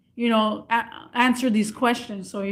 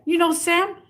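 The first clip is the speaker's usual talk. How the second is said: scammer's question; 7 semitones higher than usual. Retold or imitated. Voicing the scammer.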